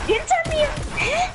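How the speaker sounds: very high startled shriek; shrieking surprise. Real, not acted. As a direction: yelp it in shock. Startled during gunfight.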